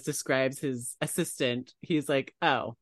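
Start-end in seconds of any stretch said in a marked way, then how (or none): none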